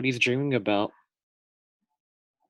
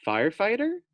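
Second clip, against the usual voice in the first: curious surprise; 10 semitones higher than usual. Acted. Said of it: "thought Fluffy".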